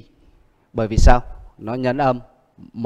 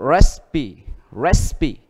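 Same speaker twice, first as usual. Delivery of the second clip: Recipe with a punched REC; REC-ipe, first syllable strong; stress on first syllable; 3 semitones higher, 3 dB louder, much slower than usual. Shown as a demonstration. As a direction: hit the start of Recipe, then fall away. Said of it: "Nó nhấn âm đầu".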